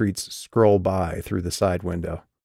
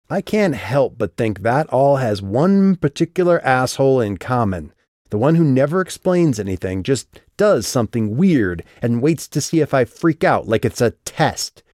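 louder, higher, furious outburst; angry; 5 semitones higher, 5 dB louder than usual. Acted. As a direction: let the anger rip. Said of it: "Calliope's jaw was tight".

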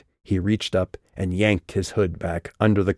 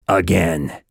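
growled; 4 dB louder than usual. Acted. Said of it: "he growled".

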